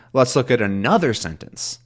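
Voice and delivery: "another" punched on its middle syllable, "sentence" falling away; stress on another. Shown as a demonstration. Teacher showing a shifted meaning, stress on another. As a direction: hit "another" and let "sentence" drop. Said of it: "changes the stress from sentence to here".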